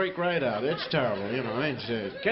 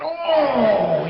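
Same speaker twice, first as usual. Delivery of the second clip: higher, much louder cry of pain; pained yelp; 10 semitones higher, 12 dB louder than usual. Retold or imitated. Player hit in the groin yelps in pain, loud.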